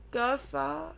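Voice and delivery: usual speaking voice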